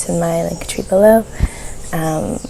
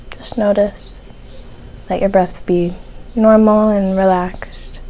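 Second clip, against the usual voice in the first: guided meditation; 5 dB quieter than usual. Real, not acted. Host guiding listeners to soften their focus, guided meditation. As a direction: ease listeners gently into calm, phrase by phrase.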